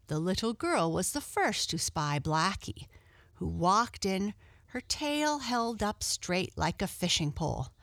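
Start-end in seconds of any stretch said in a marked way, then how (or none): none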